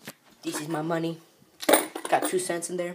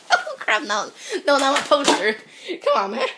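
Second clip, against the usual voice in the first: high, loud cry of protest; loud dismay; 7 semitones higher, 6 dB louder than usual. Real, not acted. Vlogger seeing the poster fall, loud dismayed protest. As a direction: exclaim in alarm, half laughing.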